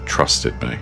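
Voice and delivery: ordinary delivery, nothing marked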